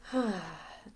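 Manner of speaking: short breathy sigh, falling away; releasing sigh. Shown as a demonstration. Leader showing listeners how to let it go, sighing exhale. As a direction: let it all out on one breath. Demonstrates letting energy go.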